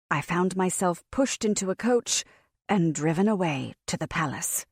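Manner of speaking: plain reading voice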